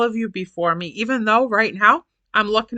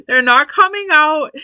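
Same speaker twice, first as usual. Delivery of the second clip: higher and louder, stressing out; exasperated repeat; 8 semitones higher, 6 dB louder than usual. Real, not acted. Admitting to viewers her words won't come, exasperated. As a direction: throw the words up in frustration. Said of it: "laughs, then repeats louder".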